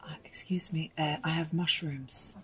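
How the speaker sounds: hushed, unvoiced, slow English whisper; embarrassed whisper to the pharmacist; whispered confession. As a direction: whisper it so no one else hears. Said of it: "prøvde jeg å hviske til hun farmasøyten".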